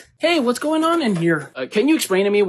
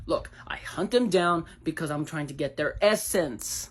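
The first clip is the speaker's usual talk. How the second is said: usual speaking voice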